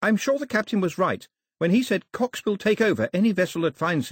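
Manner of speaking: put-on high girl's character voice; a girl earnestly making her case, pitched well up; high-pitched, serious young heroine; Daphne, reasoning.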